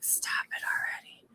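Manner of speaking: whispered, unvoiced; retold whisper. Owner whispering her own thought about the blue-eyed puppy, unvoiced. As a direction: breathe it out with no voice. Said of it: "Oh, I'm like".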